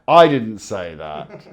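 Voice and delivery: loud, drawn-out denial through amusement; jokey, emphatic disclaimer; amused protest. Real, not acted. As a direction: protest loudly and slowly, half laughing. Mock protest at interviewer's interjection.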